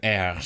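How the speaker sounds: vowel gliding into a rolled R; trilled R. Shown as a demonstration. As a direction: roll the R in "R" after a vowel. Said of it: "from a vowel to the trill".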